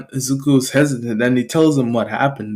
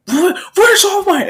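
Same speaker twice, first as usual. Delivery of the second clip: very high, stammered question; alarmed demand. Acted. Boy confronting a stranger, alarmed. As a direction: pitch it way up in panic.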